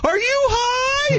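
a high, drawn-out shout of outrage; shrieked disbelief. Retold or imitated. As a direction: screech it like he's lost his mind. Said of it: "my voice went up like twenty-eight octaves".

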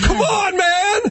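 high, strained imitated shout; mock shouted protest. Suspect flapping around at a drawn gun, mock shouting. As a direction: plead at the top of your pitch.

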